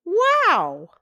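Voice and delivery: high, loud delighted gasp of wow; excited wow. Acted. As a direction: burst out with wonder at the shoes. Shoemaker finds more shoes.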